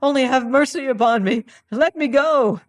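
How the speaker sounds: high, loud, fast desperate pleading; terrified man on his knees begging; begging for mercy. Acted. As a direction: beg for your life. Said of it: "he cried".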